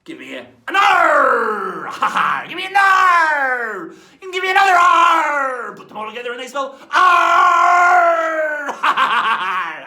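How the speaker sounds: rally the crew with a booming slow chant; pirate cheer. Acted.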